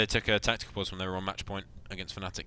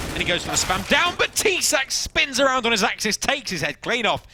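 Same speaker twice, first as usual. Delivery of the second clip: rising hype, louder and higher; excited commentary; 13 semitones higher, 7 dB louder than usual. Real, not acted. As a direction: call the big play with rising excitement. Live play-by-play big play.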